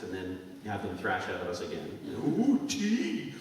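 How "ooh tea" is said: high, laughing kid's posh English put-on; mock English accent. Kids back from England showing off, mock English accent. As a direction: ham up a child's English accent. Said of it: "come back with these little accents".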